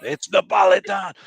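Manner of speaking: put-on Italian accent. Host insisting on the answer in a loud mock Italian accent. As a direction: bellow Napolitan like a proud Italian. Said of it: "putting on this accent".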